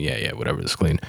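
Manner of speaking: low, soft careless brush-off; dismissive. Acted. Weary box-wiper late in the pandemic, dismissive. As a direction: wave it off like you've stopped caring. Meme figure no longer caring.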